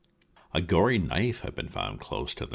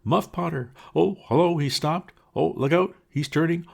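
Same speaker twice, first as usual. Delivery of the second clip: high, loud excited cries; louder, higher excited shouts; crowd calling out; 7 dB louder than usual. Acted. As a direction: call warnings across the crowd. Crowd calls out warnings.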